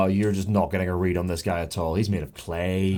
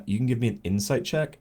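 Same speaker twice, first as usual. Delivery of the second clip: ordinary talk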